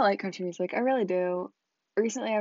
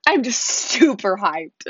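louder and slower, pushing the hype; louder, hyped; 10 dB louder, slower than usual. Real, not acted. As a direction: forces some excitement into it. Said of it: "I don't sound ... like I'm hyped".

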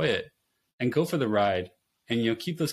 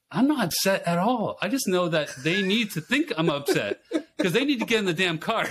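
laughing; 8 semitones higher, 3 dB louder than usual. Retold or imitated. Defends yelling, laughing.

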